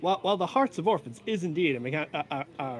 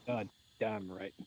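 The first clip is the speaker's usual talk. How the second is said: low gruff voice; 6 semitones lower, 10 dB quieter, slower than usual. Acted. Player's character approves servants.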